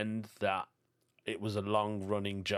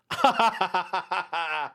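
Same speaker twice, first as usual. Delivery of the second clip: higher, louder rattling laugh; staccato laugh; 6 semitones higher, 5 dB louder than usual. Real, not acted. Comedian laughing at his own lift story, staccato laugh. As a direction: crack up in rapid ha-has.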